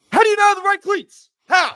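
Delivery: shouting the question high and loud in disbelief; furious outburst. Real, not acted. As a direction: blow up at the player over the cleats. Furious rant about missing cleats.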